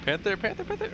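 panicked callout. Real, not acted.

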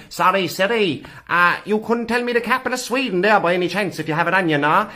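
mock broad Cork accent, rambling and politely meandering. Shown as a demonstration. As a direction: lay the Cork accent on thick. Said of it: "at your normal accent".

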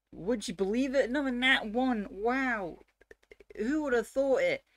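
high, loud sarcastic wonder; mock amazement. Player reporting yet another nat one, in mock amazement. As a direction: exclaim it in exaggerated fake surprise.

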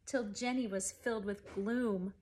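plain reading voice

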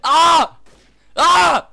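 panicked screams; being chased. Real, not acted.

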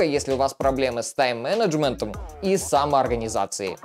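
normal talking voice

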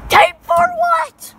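slow, high, loud disbelief; asked about tape, shouting in disbelief; incredulous shout. Real, not acted. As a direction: draw it out in disbelief.

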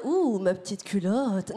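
low, slow mock seductive coo; mock sexy. To the audience, mocking the sexy bathroom fantasy, slow low coo. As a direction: purr it like a cheesy sexy fantasy. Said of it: "faut pas nous imaginer en mode".

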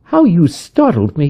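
a mild start of surprise; wife tells Kate she startled her. A narrator's reading.

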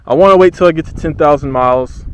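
high, loud excited exclaim. Real, not acted. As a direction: blurt it out thrilled. Over nine thousand meme.

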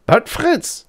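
a high startled outburst on Fritz; startled cry. Acted. Old professor startled by his pupil's refusal, crying out high. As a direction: cry out, caught off guard. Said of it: "the professor cried, very much startled".

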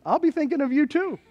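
high-pitched, playful comeback; mock sweet reply. Churchgoer answering the boating realtor, high mock sweet reply. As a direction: lift the reply high, teasingly.

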